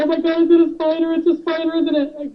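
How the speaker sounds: high, hurried mock panic; retold fright. Wife fearing a spider on the hotel bed, panicked. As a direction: re-enact frantic guessing.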